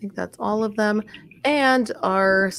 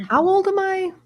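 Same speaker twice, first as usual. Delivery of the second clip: mock surprise; 11 semitones higher than usual. Mocking an inner question.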